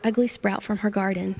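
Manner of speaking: usual speaking voice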